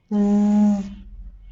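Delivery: জ said as a buzzing z; জ, a clear English z; z sound. Shown as a demonstration. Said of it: "সেটা হলো".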